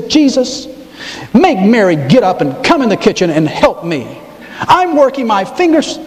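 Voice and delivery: high, complaining sister voice; mock whine at Jesus from the kitchen; whiny Martha. Martha whining high to Jesus about Mary. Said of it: "She says".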